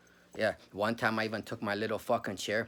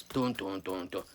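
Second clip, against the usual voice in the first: imitated drumbeat; 3 semitones lower, 3 dB quieter, slower than usual. Proving the noises he heard were distinctive, mimicked drumbeat. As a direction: drum sounds voiced on even beats. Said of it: "I never heard no drums".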